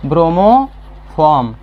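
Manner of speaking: slow, stretched chemical name; drawn-out key term. Real, not acted. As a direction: dictate the name slowly to a class. Teacher answers own question with key term.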